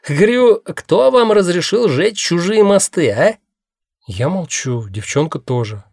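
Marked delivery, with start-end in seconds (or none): 0.1-3.4 annoyed. A narrator's reading. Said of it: "сердито хмыкает".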